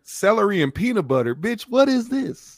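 high-pitched, drawn-out disbelief; incredulous. Retold or imitated. Mock outrage at snacks offered.

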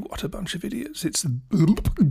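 lightly imitating a snooty clever person; mock the clever listener, high and breathy; smug know-it-all. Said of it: "clever person out there thinking".

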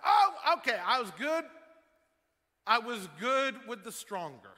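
high, loud mock objection from a reluctant listener; mock protest. Preacher voices reluctant churchgoers, mock protest. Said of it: "some of you are just like".

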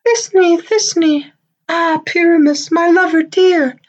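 tiny falsetto lady voice, very high; monstrous little voice. Acted. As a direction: squeak it out like a dainty maiden. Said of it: "I'll speak in a monstrous little voice".

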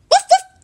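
loud high yapping woof woof; dog barks. Acted. Toby barks at the teddy bear, loud and high. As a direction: bark like an excited little dog. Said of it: "barked Toby".

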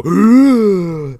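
loud imitated gasp of a man coming back to life; revival gasp. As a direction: suck in air loudly like reviving. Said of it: "he was gonna go".

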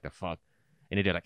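ordinary delivery

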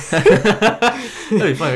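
high, slow giggling words; real laughter over own joke; laughing. Real, not acted. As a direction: laugh through the line.

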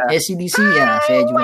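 mock shout. Mock outburst over [name].

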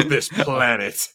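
hammed-up gritty rogue cop monologue, slowing down, laughing through it; mock noir cop. Joking about a fired guard turning rogue cop, mock noir narration. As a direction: narrate like a brooding detective in a cheap film noir. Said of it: "and go".

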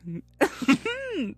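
high gloating laugh; high cackle. Real, not acted. Laughing at karma.

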